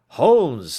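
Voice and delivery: sudden loud cry of alarm; cried out. A narrator's reading. Watson cries out to Holmes over the newspaper. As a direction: call his name in shock. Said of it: "I cried".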